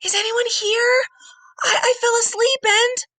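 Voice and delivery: high, tearful plea; scared crying call. A narrator's reading. Trapped boy calling into the empty dark hall, near tears.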